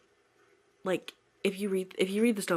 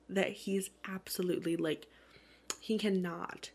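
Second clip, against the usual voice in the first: ordinary talk, nothing marked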